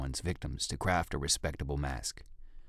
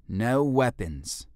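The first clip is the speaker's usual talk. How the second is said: much higher, louder, very slow order; slow firm command; 7 semitones higher, 6 dB louder, much slower than usual. Acted. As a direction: lay down the rule word by word. Jolie forbids weapons.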